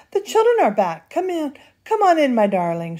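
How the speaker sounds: high-pitched, eager welcome; delighted cry. A narrator's reading. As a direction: call them in, pitched high. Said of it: "she cried".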